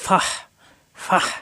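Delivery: ফাহ ending in a strong, airy h from mid-throat; pressed throat h. Shown as a demonstration. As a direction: squeeze breath out at the end of ফাহ. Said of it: "কণ্ঠনালীর মাঝখানে চাপ দিয়ে". (ফাহ, কণ্ঠনালীতে চাপ দিয়ে)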